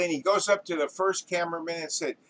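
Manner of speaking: ordinary talk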